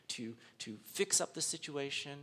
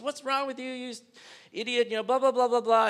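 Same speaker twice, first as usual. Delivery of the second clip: higher, louder imitated insult; mock yelling stranger; 8 semitones higher, 8 dB louder than usual. Preacher imitates a stranger yelling at him, mock angry shout. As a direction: throw the insult like an impatient stranger. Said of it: "yells at you".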